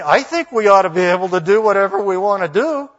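emphatic acted complaint; mock demand. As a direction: parody a self-entitled protest. Mimics modern rebellious demand.

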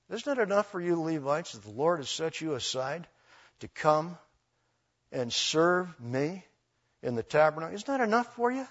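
soft, measured acted rebuke; hushed questioning, lifting at the end; quiet reproach. Moses reproaches the Levites, acted.

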